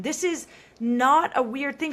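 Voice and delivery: ordinary delivery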